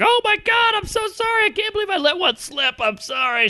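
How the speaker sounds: high, prim mock-feminine apology; girlfriend apologizing. Girlfriend caught farting, squeaky high apology. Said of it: "She's like".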